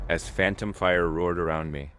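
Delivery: usual speaking voice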